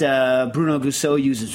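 ordinary delivery

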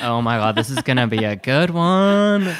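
loud, slow, delighted aside; gleeful anticipation. Retold or imitated. Rider settling in to watch a fight, loud and gleeful. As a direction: savor the coming drama. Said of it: "I'm like".

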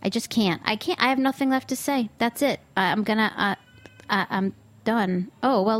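usual speaking voice

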